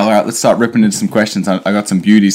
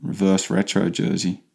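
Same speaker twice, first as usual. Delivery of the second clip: ordinary delivery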